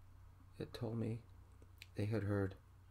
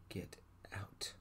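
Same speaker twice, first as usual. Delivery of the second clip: hushed, half-whispered order; soft, breath-heavy echo of dad; dad's remembered voice; 3 semitones lower, 7 dB quieter than usual. A narrator's reading. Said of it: "my dad said".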